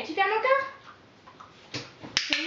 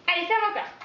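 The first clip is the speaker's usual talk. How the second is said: normal talking voice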